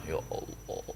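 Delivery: hushed, breathy prayer at a near whisper; mock whispered prayer. Shown as a demonstration. Preacher mocks a confused prayer, in a faint whisper. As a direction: pray faintly as if God were nowhere in particular. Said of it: "begini kok doanya".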